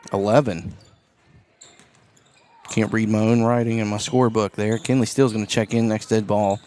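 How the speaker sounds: brisk courtside basketball call; play-by-play. Acted. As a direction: call the game as it happens.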